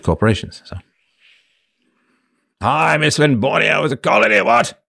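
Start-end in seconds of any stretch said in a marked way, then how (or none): 2.6-4.8 high, hooting pompous British colonial; pompous British imperialist. Acted. Host greeting as a colonial character, pompous British accent. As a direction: greet them as a British empire snob. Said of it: "nineteenth century British pompous accent".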